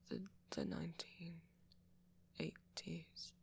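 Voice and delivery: hushed, halting confession; reluctant admission. Acted. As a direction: admit it softly, almost under your breath. Reluctantly admitting when turned.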